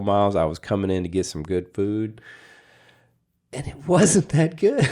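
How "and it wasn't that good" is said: breaking into a laugh mid-line. Real, not acted. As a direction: laugh it out, voice jumping up. Laughs while complaining.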